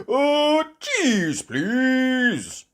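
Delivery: high, loud, booming order; Grampy Rabbit. Acted. Grampy Rabbit ordering from the boat, high loud voice. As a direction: holler it out high.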